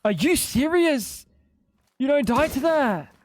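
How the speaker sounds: high-pitched disbelief; incredulous outcry. Real, not acted. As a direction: exclaim in outraged disbelief, pitch jumping up. Shot fails to kill in game.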